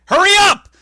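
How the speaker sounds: very high, loud "hurry up"; impatient yell. Retold or imitated. The clerk rushing Edge along, mock yell. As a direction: bark at them to hurry. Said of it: "And the guy's like".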